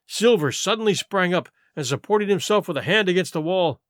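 ordinary delivery, nothing marked

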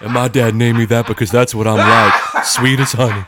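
sweet as honey, hammed up; imaginary daughter introduces herself sweetly; Honey Schneider impression. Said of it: "your impression of Honey Schneider".

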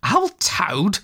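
high, mildly amused exclamation. A narrator's reading. Rat answers Mole about brave Toad, lightly amused. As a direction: scoff fondly at the very idea. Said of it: "laughing heartily".

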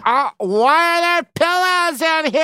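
very high, whiny-style exaggerated imitation; hammed-up horror at a trifle; mock snooty complaint. Mimicking newcomer's absurd complaints.

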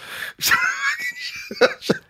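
very high shrieking burst of laughter. Real, not acted. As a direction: howl with laughter. Explodes laughing.

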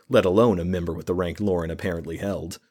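ordinary delivery

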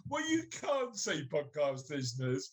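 normal talking voice

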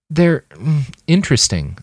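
tactful hesitation; diplomatic. A narrator's reading. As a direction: hedge politely before settling on a tactful word.

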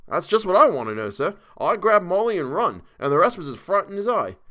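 put-on insistent Cockney old soldier; Cockney dialect. A narrator's reading. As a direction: defend your courage to your employer in Cockney. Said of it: "Thomas's Cockney dialect".

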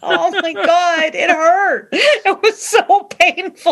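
high, slow, laughing through the words. Real, not acted. Laughing with cohost about an awful accent, high and slow. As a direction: laugh while remembering the pain. Recalling a painful bad accent.

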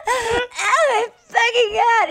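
high, slow, drawn-out shout of disgust; disgusted shriek. Retold or imitated. Women recoiling from Romeo's advances, disgusted shriek. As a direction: shriek it in horror.

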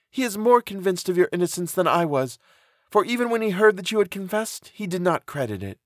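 high-pitched, earnest female character; reassuring friend. A narrator's reading. Elizabeth reassures Justine.

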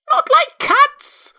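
very high, loud, drawn-out squeal of outrage; shrill, passionate mouse. A narrator's reading. The mouse cries out at Alice, shrill and passionate. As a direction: shriek slowly as an outraged mouse. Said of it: "in a shrill, passionate voice".

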